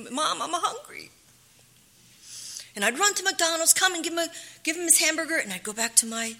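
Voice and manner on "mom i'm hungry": put-on high childlike plea; child pleading. Son asking his mother for food in the morning, high. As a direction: plead up high like a little boy.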